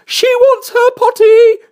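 loud, very high, slow call; high, drawn-out servant's cry; maid crying out. A narrator's reading. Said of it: "cried the maid".

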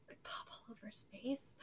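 very soft, almost no voice; creeped-out hush. Real, not acted. Reactor describing boils on a face, hushed. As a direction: drop to a near whisper.

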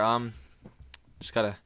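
ordinary talk

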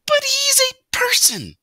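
very high fussy mother mouse; worried mother mouse. A narrator's reading. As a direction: fret like an anxious mother mouse.

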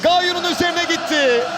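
normal talking voice

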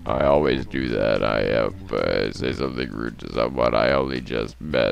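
croaky low voice with long "grrr" ribbits; very deep voice. Acted. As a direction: drop to the very bottom of your range. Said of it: "it's so deep that the microphone".

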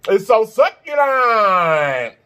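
It's so succulent, drawn out very slowly; slow savouring drawl. Real, not acted. Savouring the ham.

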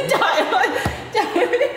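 high-pitched helpless laughter; cracking up mid-sentence; laughing hard. Real, not acted. Cracking up at the face.

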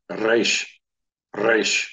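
"Reish" with a trilled Spanish-style R; rolled R. Shown as a demonstration. Teacher demonstrating the Israeli trilled R to students. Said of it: "if it's a trill".

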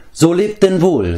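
stretched-out parting words from a dwarf; dwarf's farewell. A narrator's reading. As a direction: say farewell slowly and high, as a dwarf.

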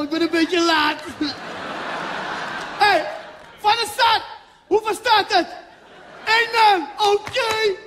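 high, loud, laughing coach bursting onto the pitch; Surinamese bondscoach arriving. Retold or imitated. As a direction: play the late, overexcited Surinamese coach yelling at his team. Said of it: "Let op".

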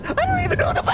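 very high shrieked plea; screaming in terror. Real, not acted. Pleading turns to scream.

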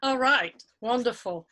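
ordinary talk

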